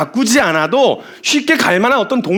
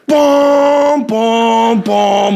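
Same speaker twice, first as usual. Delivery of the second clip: long droning mock horn notes; imitated instrument blasts; much slower than usual. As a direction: hold each note out like a blaring instrument. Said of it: "악기 소리들이 막 나오잖아요". (악기 소리 흉내)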